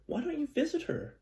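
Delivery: a high, lightly voiced mother; mom's voice. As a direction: ask it gently as the mom would. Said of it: "my mom says".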